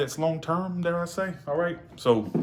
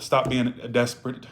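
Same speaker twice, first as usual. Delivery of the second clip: ordinary delivery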